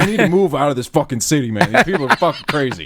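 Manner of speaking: retold exasperation. Storyteller walking out of the store, loudly fed up. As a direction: vent loudly, pitch lifted in comic disgust.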